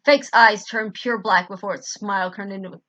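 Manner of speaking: usual speaking voice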